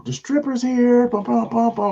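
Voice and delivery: high, loud, drawn-out entrance call; mock stripper announcement. Comic bursts into a room of women, mock fanfare. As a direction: belt it out like a party fanfare. His act's opening announcement.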